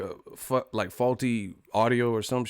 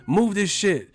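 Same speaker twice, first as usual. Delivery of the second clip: impatient drivers; 8 semitones higher than usual. Retold or imitated. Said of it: "they're like".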